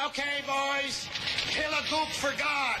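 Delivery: high, slow, pompous preacher voice; mock chaplain. Chaplain blessing the boys before battle, mock preacher voice. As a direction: drag it out like a war-film padre. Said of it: "Take it away, chaplain".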